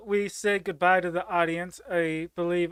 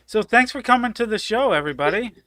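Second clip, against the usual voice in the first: host sign-off; 4 semitones higher, 6 dB louder, faster than usual. Real, not acted. Host wraps up show.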